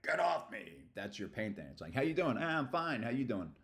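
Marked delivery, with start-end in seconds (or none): 0.0-0.8 raised, higher snap of anger; mock angry outburst; acted anger. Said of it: "They have an anger". 1.9-3.5 putting on a show. Acted. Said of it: "putting on a show".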